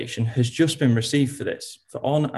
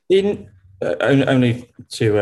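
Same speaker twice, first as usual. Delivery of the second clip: ordinary delivery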